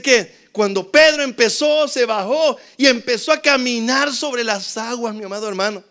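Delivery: loud, high-pitched sermon storytelling; fiery preaching. Acted. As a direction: preach it at full force to the congregation. Preaching at full force.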